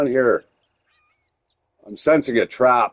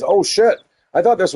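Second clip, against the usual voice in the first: surprised; 8 semitones higher than usual. Real, not acted. Sudden surprise while looting.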